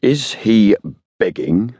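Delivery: mildly authoritative policeman's question. A narrator's reading. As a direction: question with a touch of official authority. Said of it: "his tone became authoritative".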